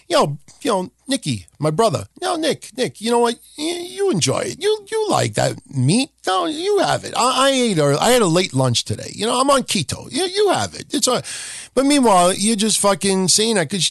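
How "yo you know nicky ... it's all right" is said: fake generous friend. Offering mystery meat to Nick, fake generous and high. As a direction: push the gross food on Nick sweetly. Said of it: "You're like".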